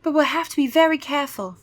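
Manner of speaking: sly vampire girl, mildly voiced; lightly voiced Gwendolyn. Gwendolyn warning the kids, lightly voiced.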